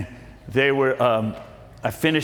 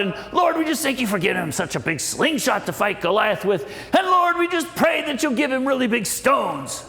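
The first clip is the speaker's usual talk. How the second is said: high-pitched imitation of a fervent Pentecostal woman; worked-up praying; 8 semitones higher than usual. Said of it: "she was really worked up".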